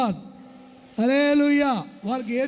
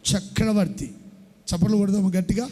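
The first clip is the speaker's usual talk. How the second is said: usual speaking voice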